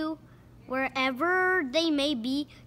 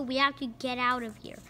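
ordinary delivery, nothing marked

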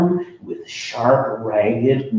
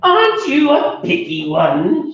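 breathy, huffy put-down; haughty sniff; 12 semitones higher, 5 dB louder than usual. Acted. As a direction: sniff at him disdainfully. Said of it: "she sniffed".

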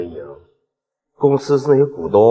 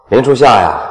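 mocking laugh; 8 dB louder than usual. Villain mocks the heroine's name.

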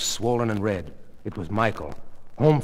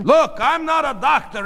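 raised, high, insistent voice; defensive arguing; 7 dB louder than usual. Acted. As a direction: insist loudly that it's nothing. Husband defends himself.

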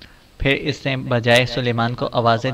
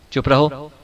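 usual speaking voice

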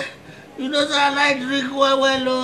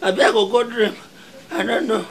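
ordinary delivery

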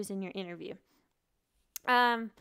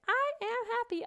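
much higher puppet voice; tiger puppet voice; 12 semitones higher than usual. Pretend. The tiger puppet answers the teacher in a high cartoon voice. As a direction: squeak it like a cute puppet tiger. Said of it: "And then Tiger will say".

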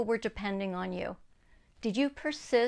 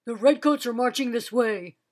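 warning; 6 dB louder than usual. Acted. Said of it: "warned the messenger".

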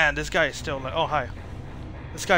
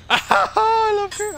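delighted laughing squeal; 6 dB louder, much slower than usual. Real, not acted. Delighted by the game's killer.

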